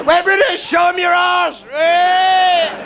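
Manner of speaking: high, loud yob chant; rowdy shout. Retold or imitated. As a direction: yell it like drunk tourists abroad. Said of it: "how you behave on holiday".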